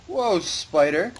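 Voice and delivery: slow, high drawn-out whoa; drawn-out startled. Real, not acted. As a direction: stretch it out in alarm. Repeats spider, slow and high.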